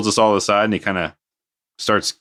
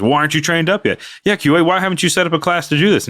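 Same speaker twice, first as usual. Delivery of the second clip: higher, indignant retold telling-off; mock yelling; 8 semitones higher, faster than usual. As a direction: imitate a manager chewing out the crew, raised pitch. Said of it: "start yelling at your guys".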